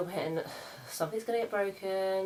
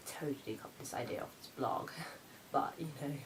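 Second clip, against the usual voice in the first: whispered confession; 3 semitones lower, 7 dB quieter than usual. Real, not acted.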